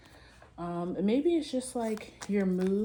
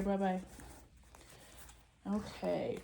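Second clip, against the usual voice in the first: ordinary talk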